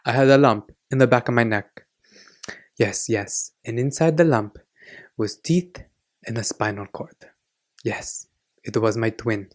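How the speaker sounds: put-on quoting the aunt in a Greek accent. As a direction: play the oversharing Greek aunt with a thick Greek accent, trailing soft. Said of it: "she talks in this accent".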